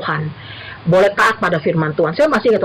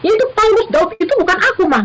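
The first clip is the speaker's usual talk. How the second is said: put-on high, whiny child's objection; arguing child; 10 semitones higher than usual. Daughter arguing with her mother, high whiny child voice. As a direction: whine back that those were different times. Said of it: "Lalu dia bilang".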